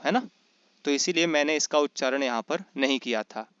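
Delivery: ordinary talk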